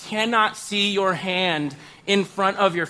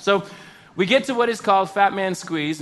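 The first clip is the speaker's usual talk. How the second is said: normal talking voice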